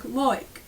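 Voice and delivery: like said as loik, oi vowel; Australian oi in loik. Shown as a demonstration. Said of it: "more pronounced like loik".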